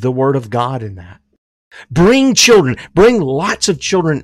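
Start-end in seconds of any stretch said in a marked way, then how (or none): 1.9-4.2 rally the congregation; loud exhortation. Real, not acted.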